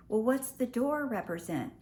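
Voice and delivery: ordinary talk, nothing marked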